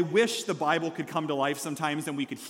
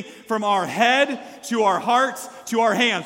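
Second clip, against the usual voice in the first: loud, slow rallying chant; big audience call-along, each part punched; 4 semitones higher, 6 dB louder, slower than usual. Real, not acted. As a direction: lead the crowd, higher and louder. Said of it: "say it with me".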